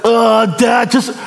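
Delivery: put-on very high, whiny teen brushing dad off; child on an iPad brushing off dad; lazy kid. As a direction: whine without looking up. Pastor voicing lazy kids on iPads.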